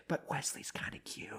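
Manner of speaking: soft whisper, fond and sly; a pretend nurse whispering about old Wesley; gossipy whisper. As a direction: murmur it like a nurse with a crush.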